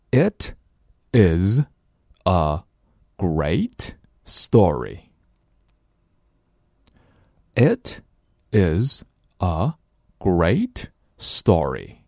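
"It is a great story" word by word, slow; slow separated reading. Shown as a demonstration. Teacher reading the sentence word by word, slowly. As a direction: say each word apart, carefully. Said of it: "我们一起来试一试".

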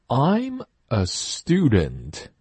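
very slow I'm a student, student with a plain stu; slow model sentence. Shown as a demonstration. Teacher modelling a sentence slowly. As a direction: spell it out slowly for copying. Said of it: "注意stu不是stew".

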